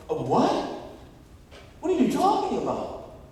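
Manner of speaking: loud, high-pitched disbelief; hammed-up baffled questioning; acted incredulity. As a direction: demand answers as if astonished. Acts out a startled reaction.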